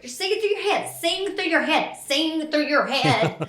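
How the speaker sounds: high repeated instruction that settles down, ending in a laugh; imitating grandma's coaching. Granddaughter mimics grandma's repeated singing note, high then laughing. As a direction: drill it like a fussy teacher, then laugh. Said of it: "after every".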